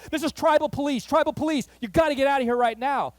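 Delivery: loud, high urgent command; officer at the door ordering them out, urgent and loud. Retold or imitated. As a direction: bark the evacuation order. Panicked officer repeats order.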